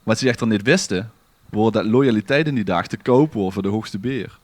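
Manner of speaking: ordinary delivery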